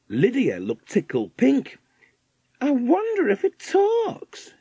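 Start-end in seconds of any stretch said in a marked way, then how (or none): 2.6-4.5 very high, slow, drawn-out lady's voice; millionaire's wife. A narrator's reading. Millionaire's wife curious about a macaw, very high voice. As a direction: wonder aloud in a high, lingering voice.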